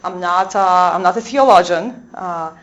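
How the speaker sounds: ordinary talk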